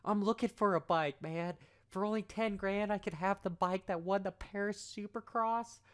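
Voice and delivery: high, mock-astonished exclamations; sarcastic amazement. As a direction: gush over it with heavy sarcasm. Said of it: "If you get the impression that I'm being sarcastic".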